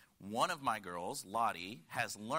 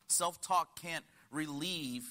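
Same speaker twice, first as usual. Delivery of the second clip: normal talking voice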